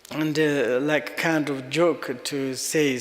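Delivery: normal talking voice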